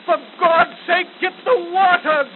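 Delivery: high, loud plea; desperate cry. Acted. Wounded soldier crying out to Gunga Din, desperate. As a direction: beg for the water with all you have.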